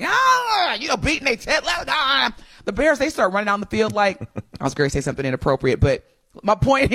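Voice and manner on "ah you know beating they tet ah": high roaring team charge; hungry battle cry. Acted. Co-host acts out the Packers charging out hungry, battle cry. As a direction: roar like a player storming the field.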